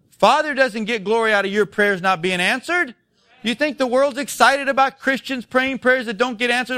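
ordinary delivery

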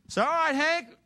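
high, loud acted holler; calling out. Preacher calls Hank to open the fire hose, hollering. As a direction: shout the order to someone far off.

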